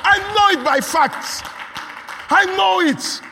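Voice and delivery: loud, high, fervent preaching; preaching at full force. Acted. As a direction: hammer it home to the congregation.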